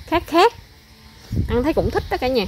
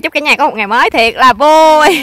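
loud, slow, higher cheerful hello to viewers; loud greeting; 6 semitones higher, 10 dB louder than usual. Real, not acted. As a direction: call out the greeting big and drawn out. (lời chào to, chậm, phấn khởi)

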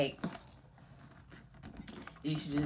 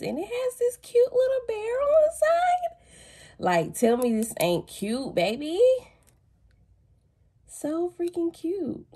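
gushing; 13 semitones higher, 4 dB louder than usual. Real, not acted. Gushing over a souvenir.

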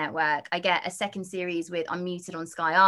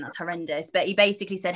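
ordinary delivery

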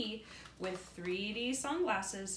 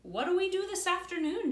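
higher, quick and keen; eager question; 9 semitones higher, 6 dB louder than usual. Acted. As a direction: ask brightly, eager to play. Eager boy asks.

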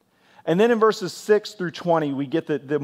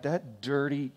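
muttered name-calling; 9 dB quieter, slower than usual. Acted. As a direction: mutter an insult under your breath. Private name-calling cut short.

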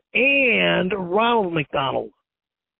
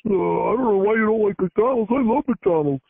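put-on dopey clerk voice; altered voice, mocking a clerk; male clerk. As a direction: imitate a dim clerk, mockingly. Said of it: "the clerk looks at me and he goes".

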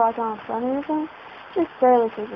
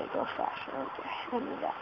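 hushed, sheepish aside; embarrassed whisper; 12 dB quieter than usual. Real, not acted. Gamer whispering, embarrassed at missing something obvious. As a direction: mutter it under your breath. Sheepish realization while playing.

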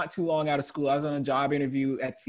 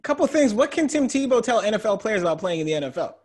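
ordinary talk

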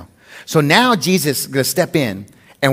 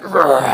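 strongman grunt. Retold or imitated. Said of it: "and they're like".

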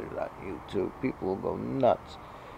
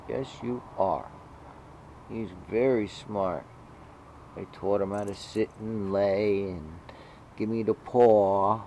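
usual speaking voice

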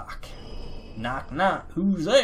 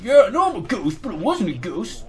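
mock ghost protest; 5 semitones higher, 5 dB louder than usual. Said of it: "You're like".

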